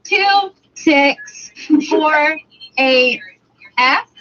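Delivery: spelled out letter by letter, slow and deliberate, starting high; slow spelling out. Retold or imitated. Pranking a scam caller by slowly spelling out an insult instead of a number. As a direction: spell out a rude word slowly, one letter at a time, to a phone scammer. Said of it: "he let me spell it out".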